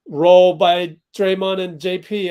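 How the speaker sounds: excited. Real, not acted. Live play-by-play, big play.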